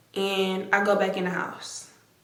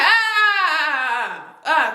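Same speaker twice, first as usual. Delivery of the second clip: cutting them off; 6 semitones higher, slower than usual. Retold or imitated. Cutting off their argument.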